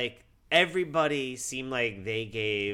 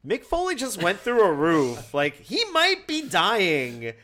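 higher, louder, mock-urgent exclamation; alarmed protest; 8 semitones higher, 6 dB louder, slower than usual. Wrestling fan alarmed over a fall through the roof, raised incredulous voice. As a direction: exclaim it in exaggerated alarm, stretching the end. Exasperated retort, set against calm "no one's dying.

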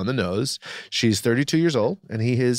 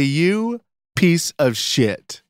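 louder, slow mock-outraged declaration; mock outrage, speaking for listeners; 5 semitones higher, 4 dB louder, much slower than usual. Host delivers the listeners' verdict to co-host, hammed-up outrage. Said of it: "I speak on behalf of all of our listeners".

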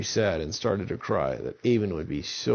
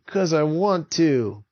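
defiant; 6 semitones higher, 5 dB louder, slower than usual. Acted. Avon defiant answer.

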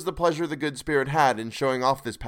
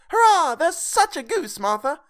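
put-on much higher, louder cheering kids; excited children; 6 dB louder than usual. A narrator's reading. Young Cratchits cheering Martha about the goose, high excited shout. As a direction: burst out with childish glee. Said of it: "cried the two young Cratchits".

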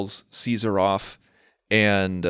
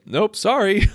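sitcom clerk; 12 semitones higher, 4 dB louder than usual. Retold or imitated.

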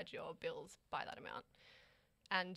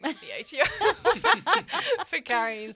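laughing; 10 semitones higher, 15 dB louder, much slower than usual. Real, not acted. Laughs while speaking.

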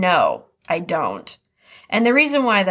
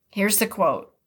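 ordinary talk, nothing marked

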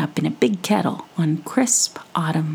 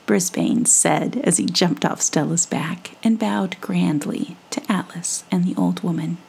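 ordinary delivery, nothing marked

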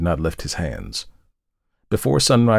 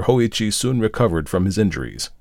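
ordinary delivery, nothing marked

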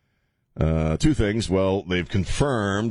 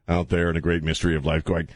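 normal talking voice

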